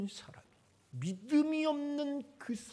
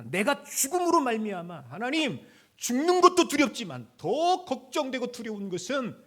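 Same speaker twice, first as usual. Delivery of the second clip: raised, urgent cry to God; pleading aloud to God; loud plea; 4 semitones higher, 11 dB louder than usual. Acted. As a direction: call it out loud. Preacher voicing David's plea.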